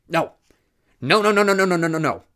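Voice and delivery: high, loud, repeated frantic no; frantic shouting. A narrator's reading. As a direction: rant like he's losing his mind. Crank man ranting in madness.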